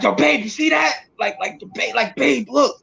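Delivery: high-pitched, astonished retold calling to a partner; shocked. Trying to tell his girlfriend what moved, in high-pitched shock. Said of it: "I was so in shock".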